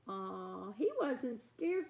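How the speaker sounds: ordinary delivery, nothing marked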